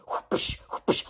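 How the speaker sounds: breathy whooshing whip noise; soft hissed whoosh, twice; whip sound effect. Pretend. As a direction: make the crack of a whip with your mouth. Mimes whipping someone.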